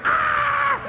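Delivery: very high shout, hurtling back up; high falling shout. Retold or imitated. Preacher reliving being pulled up to heaven, very high shout. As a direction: yell as if yanked upward. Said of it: "I go".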